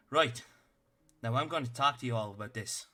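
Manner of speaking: a tiny officer barking orders at the party; stern little captain. Acted.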